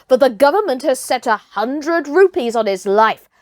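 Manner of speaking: mild stern elder rebuke; stern old hunter. Acted. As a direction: put the upstart in his place. Buldeo rebukes Mowgli.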